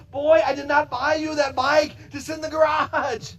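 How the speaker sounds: a dad yelling at his son; mock scolding shout. Father scolding son in parable.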